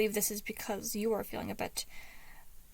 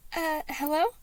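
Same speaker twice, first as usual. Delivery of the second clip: higher, bright dad-voice hello; 6 semitones higher, 3 dB louder than usual. A narrator's reading. Patton answering Logan's call, faking a cheery dad voice. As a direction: put on a cheerful dad act. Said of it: "he answered in his best dad voice".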